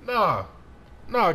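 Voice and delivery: loud, high, broken-off protests; alarmed exclaiming. Real, not acted. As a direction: protest mid-attack. Under attack in the game.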